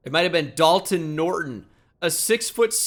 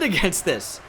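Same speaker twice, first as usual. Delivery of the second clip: normal talking voice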